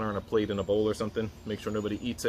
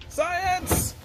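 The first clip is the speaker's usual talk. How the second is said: shrieked high and loud; excited shout; 3 dB louder than usual. Real, not acted. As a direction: yell it out like a game-show host. Countdown then launching the Mentos.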